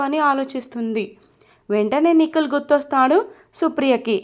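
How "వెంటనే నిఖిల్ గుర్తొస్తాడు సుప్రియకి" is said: ordinary delivery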